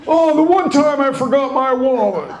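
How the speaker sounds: hammed-up innocent dad; dad's excuse. Dad caught without paying, fake surprised excuse. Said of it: "he does the signature standby".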